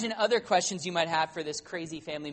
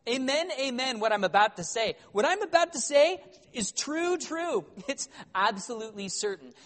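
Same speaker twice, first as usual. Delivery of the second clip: high, grandly emphatic pronouncement; a hammed-up prophetic proclamation; solemn declaring; 6 semitones higher, 4 dB louder than usual. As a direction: declare it like an oracle. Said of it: "Jesus always goes around beginning his statements with the word amen".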